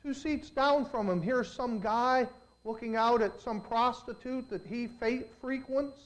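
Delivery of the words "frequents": ordinary talk, nothing marked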